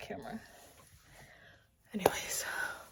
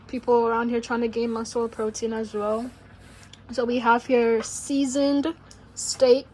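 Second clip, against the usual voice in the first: louder, upbeat presenter voice; cooking show host; 12 dB louder than usual. Acted. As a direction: sell the menu to the viewers.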